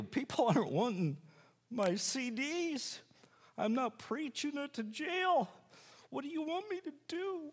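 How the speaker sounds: high-pitched helpless appeal to the Lord; pleading prayer. Retold or imitated. Retold desperate prayer to God.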